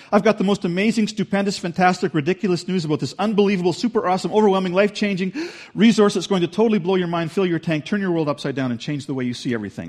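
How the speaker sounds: high, loud, rushing string of superlatives; over-the-top excitement. Retold or imitated. Paul sharing amazing news, rushing and over-the-top excited. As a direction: gush like an overexcited herald. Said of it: "that's kind of the tone of this passage".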